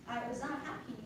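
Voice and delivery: ordinary talk, nothing marked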